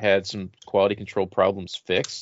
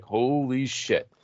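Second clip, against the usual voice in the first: awed disbelief; 4 semitones higher, 3 dB quieter, much slower than usual. Retold or imitated. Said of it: "and I was like".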